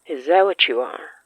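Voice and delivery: ordinary delivery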